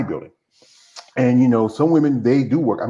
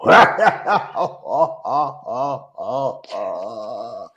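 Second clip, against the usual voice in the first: half laugh, half sob; laughing through tears. Real, not acted. Guest breaks down after complimenting the host, laughing through tears. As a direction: let a tearful laugh spill out.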